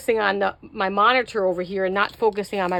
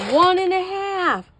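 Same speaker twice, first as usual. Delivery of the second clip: slow emphatic; 7 semitones higher, 4 dB louder, slower than usual. Real, not acted. Correcting her own measuring mix-up.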